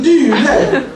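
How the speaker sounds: ordinary talk, nothing marked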